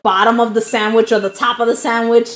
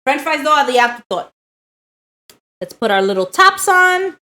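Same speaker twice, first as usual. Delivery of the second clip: ordinary talk, nothing marked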